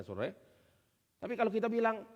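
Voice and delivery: ordinary delivery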